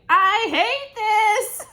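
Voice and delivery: high, loud, slow crying whine; whiny complaint. Pretend. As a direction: wail it out like a miserable pregnant woman. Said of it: "I can almost hear you saying".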